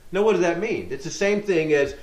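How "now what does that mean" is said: usual speaking voice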